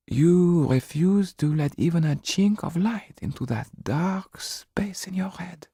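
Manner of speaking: gentle pleading. Acted. Mrs Laxton pleads with Jan, fading to a whisper. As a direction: plead then drop to a whisper.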